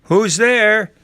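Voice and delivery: put-on high, loud old grandma voice; crying out. A narrator's reading. Grandma calls to whoever knocked, crying out. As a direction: call out sharply from your bed. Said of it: "cried Grandma from her bed".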